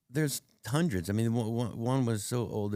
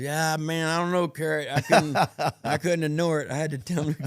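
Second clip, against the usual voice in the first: higher, animated voice over laughter; retold laughing admission; 8 semitones higher, 5 dB louder than usual. Singer admitting to Carrot he couldn't ignore it, laughingly. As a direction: act out an amused, helpless confession. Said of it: "laughed. He goes".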